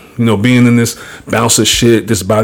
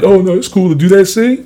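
mock prankster; 9 semitones higher than usual. Mocking a prankster's excuse.

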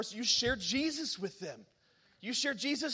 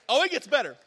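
usual speaking voice

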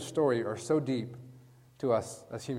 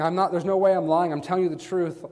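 raised, forceful protest of honesty; insistent denial; 5 semitones higher, 6 dB louder than usual. Acted. Peter insists he isn't lying, loud and forceful. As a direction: protest loudly that it's the truth. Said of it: "stronger and more elaborate".

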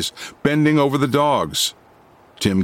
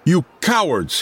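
outraged cry at the crowd; 6 semitones higher than usual. A narrator's reading. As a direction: fling the accusation at the cowards. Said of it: "he cried".

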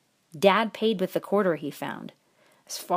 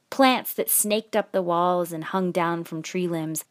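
plain reading voice

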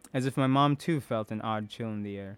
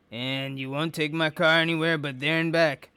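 gruff suspicious man; 4 semitones higher, 5 dB louder than usual. A narrator's reading. Gabe voiced.

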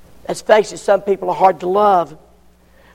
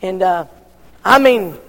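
usual speaking voice

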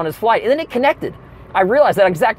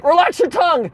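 doctor voice; 10 semitones higher than usual. Retold or imitated. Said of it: "He's like".

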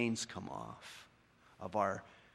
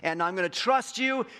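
high, louder earnest vow; believer's pledge; 8 semitones higher, 6 dB louder than usual. Retold or imitated. Preacher voicing believers pledging to follow Jesus, louder. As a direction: promise Jesus your life eagerly. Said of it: "We said".

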